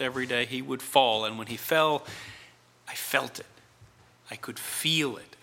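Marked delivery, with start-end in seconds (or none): 2.9-5.4 soft breathy whisper. Real, not acted. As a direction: breathe it out, barely voiced. Tender memory of son's fall.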